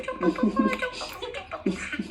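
excited squeal. Real, not acted. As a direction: squeal it with a laugh. Reading donation messages, giddy.

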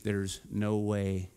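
hushed, slow no way; doubting. Retold or imitated. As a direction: mutter the doubt to yourself. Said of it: "you're gonna think".

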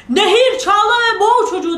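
high, loud roared command; giant shouting. Acted. Giant orders the river to drown the boy, shouting. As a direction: bellow an order as a storybook giant. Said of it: "diye bağırmış dev".

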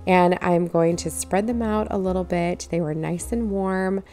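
ordinary delivery

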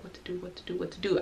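hushed, muttered mock panic; quiet imitation of panicking; freaking out, retold. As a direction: fret under your breath, very softly. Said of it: "freaking out".

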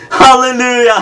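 joyful laugh breaking through; laughing hallelujah. Real, not acted. Preacher overcome with joyful laughter before the congregation. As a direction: laugh out the praise.

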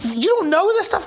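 high, loud mock disbelief; incredulous coach. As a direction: act stunned he doesn't know. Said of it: "he's like".

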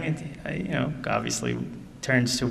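usual speaking voice

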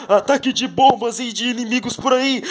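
high, loud battle alarm; frantic warning of attack; alarmed announcement. Pretend. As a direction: raise the alarm.

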